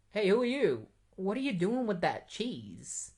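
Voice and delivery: high, put-on questioning voice; mock-indignant scientist, swooping pitch; voicing a challenging scientist.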